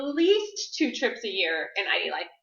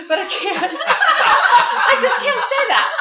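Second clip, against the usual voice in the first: higher, louder, slow, laughing through the words; real laughter; 12 semitones higher, 11 dB louder, slower than usual. Real, not acted. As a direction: break into giggles while admitting it. Joking about her daughter.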